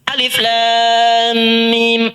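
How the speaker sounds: Alif Lam Mim chanted very slowly, each letter held long; drawn-out Quranic recitation. Shown as a demonstration. Teacher chants the letters slowly to show the reading. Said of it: "tapi bacaannya bisa beda".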